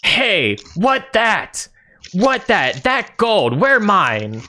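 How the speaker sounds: high-pitched, excited ogre demanding; greedy ogre. Acted. Ogre coming downstairs spotting gold, high greedy ogre voice. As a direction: pipe up high, simple ogre wanting a share. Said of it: "this ogre starts coming down the stairs and says".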